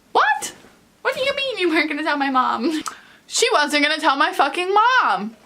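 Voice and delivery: sudden high squeak of disbelief; high, fast incredulous what; shocked disbelief. Real, not acted. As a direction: react in total shock. Shocked reaction.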